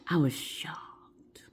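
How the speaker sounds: slow, breath-only whisper; shocked hush. Retold or imitated. Storyteller revealing the mother-in-law, shocked. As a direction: let it drop to a stunned whisper. Stunned aside after the reveal.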